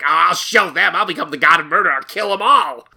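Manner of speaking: loud, petulant villain vow; sulky kid swearing revenge; vengeful villain. Pretend. God's petulant vow voiced.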